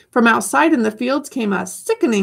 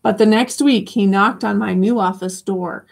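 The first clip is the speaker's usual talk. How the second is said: usual speaking voice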